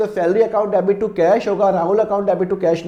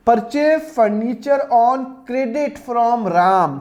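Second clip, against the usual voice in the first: very slow, word by word; slow dictation; 3 semitones higher, much slower than usual. Shown as a demonstration. Teacher reading the next question to students, very slow dictation. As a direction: dictate the question so everyone copies it. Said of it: "reads next question aloud".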